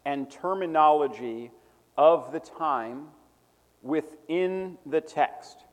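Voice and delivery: measured, phrase by phrase; dictating. Real, not acted. Repeating a definition for note-takers.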